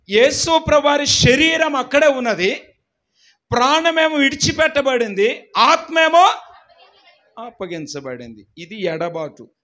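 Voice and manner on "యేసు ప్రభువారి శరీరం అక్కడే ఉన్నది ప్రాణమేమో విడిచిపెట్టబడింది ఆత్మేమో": raised, higher preaching shout; preacher proclaiming to the church; fervent preaching. Real, not acted. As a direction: declare it with rising fervour. Preaching climax.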